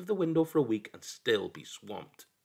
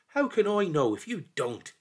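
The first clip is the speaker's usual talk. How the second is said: complaining; 7 semitones higher, 4 dB louder than usual. A narrator's reading. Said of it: "he complained".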